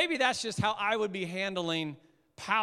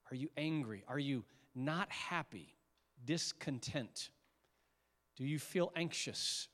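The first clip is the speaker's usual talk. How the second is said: soft, hushed prayer voice; quiet prayer; 4 semitones lower, 5 dB quieter than usual. Acted. Pastor leading the congregation into prayer, hushed. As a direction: pray gently and low with the room.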